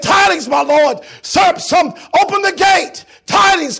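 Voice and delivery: loud, high call at the gate; messenger calling out. Acted. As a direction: cry out news to the king.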